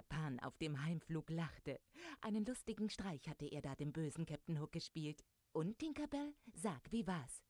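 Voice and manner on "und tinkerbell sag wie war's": lively call, pitch jumping up at the start. A narrator's reading. Peter asks Tinkerbell on the flight home, calling out. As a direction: call brightly to a companion, high start easing down. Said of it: "rief er".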